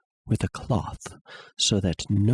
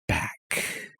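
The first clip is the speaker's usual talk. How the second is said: chilled whisper; 6 dB quieter than usual. A narrator's reading. Narrator recalls cold fear.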